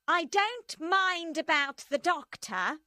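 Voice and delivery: high, loud and slow dismissal; Madame Defarge voiced. A narrator's reading. Madame Defarge dismissing the doctor, high and slow.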